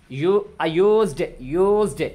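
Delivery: used said slowly as yoozd, opening with a y glide; slow model word. Shown as a demonstration. Teacher drilling used slowly for students. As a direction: lean into used slowly, y sound first. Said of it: "देखें यहाँ पे".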